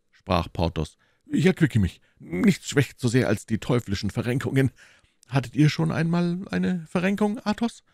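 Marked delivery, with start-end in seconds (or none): 1.3-7.8 high, animated character voice; blustering musketeer host; self-excusing musketeer. Acted. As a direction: justify your feast to your friends. Reader voices the convalescent host.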